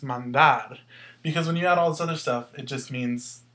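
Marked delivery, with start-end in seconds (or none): 0.0-0.8 "mandar" said man-DAR, the end louder; stress on final syllable. Shown as a demonstration. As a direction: punch the last syllable of "mandar". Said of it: "where you hear the stress".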